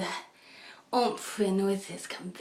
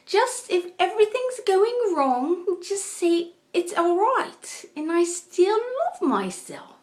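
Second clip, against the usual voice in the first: much higher, slow imitation of her lovely accent; her accent, Daylight Out of Darkness; 11 semitones higher, 4 dB louder, slower than usual. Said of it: "I love her accent".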